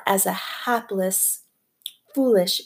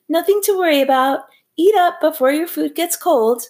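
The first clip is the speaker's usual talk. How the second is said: higher, louder, fast brightness covering panic; forced cheerful reassurance; 7 semitones higher, 4 dB louder, faster than usual. A narrator's reading. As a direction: hurry to calm the diners. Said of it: "Grace Wexler explained".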